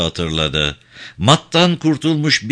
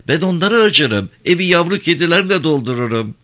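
higher-pitched voice for a brazen woman talker; outspoken Ayşe; 5 semitones higher than usual. Acted. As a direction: play her high, frank and unbothered. Narrator voices talkative Ayşe. (pervasız, tiz sesli Ayşe)

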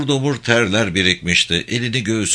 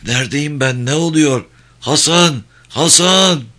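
higher, louder, building calls; calling out; 6 semitones higher, 4 dB louder than usual. Acted. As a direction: wake confused and cry out for a comrade. Wounded soldier calling for comrade. (telaşla seslenerek)

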